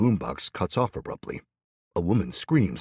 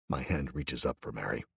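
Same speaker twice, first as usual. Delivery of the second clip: ordinary delivery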